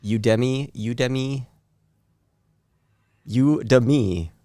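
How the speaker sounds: Udemy said as YOO-duh-mee, slowed; pronouncing the name. Shown as a demonstration. Host asks about the name, guest says Udemy as YOO-duh-mee. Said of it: "Is that how it's pronounced?".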